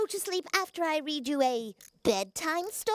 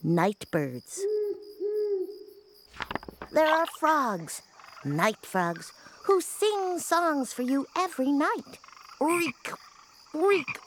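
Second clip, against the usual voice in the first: bedtime storytelling voice. Acted. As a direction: read it like a gentle bedtime story.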